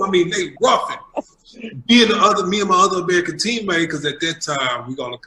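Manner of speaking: ordinary delivery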